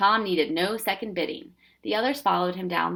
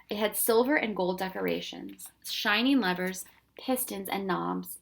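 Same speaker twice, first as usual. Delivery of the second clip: ordinary delivery, nothing marked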